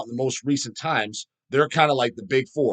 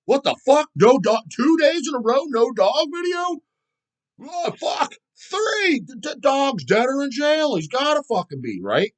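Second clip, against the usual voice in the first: much higher and louder, rising alarm; mock panicking fan; 12 semitones higher, 4 dB louder, slower than usual. Fan with no video for days, mock panic. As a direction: ham up a fan losing it. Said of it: "And day two comes by".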